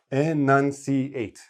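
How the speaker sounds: "Enunciate" said very slowly, each syllable clear; enunciated slowly. Shown as a demonstration. Teacher modelling careful enunciation. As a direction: stretch out every syllable of "Enunciate". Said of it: "Stress every syllable".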